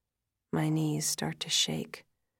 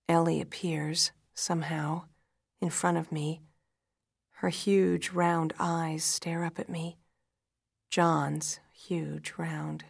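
ordinary delivery, nothing marked

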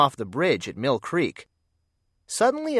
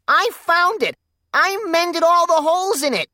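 squeaked; 6 dB louder than usual. Acted. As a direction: squeak it out, much higher and louder. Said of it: "squeaked Clarence".

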